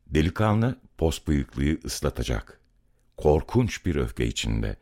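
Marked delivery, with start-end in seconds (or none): none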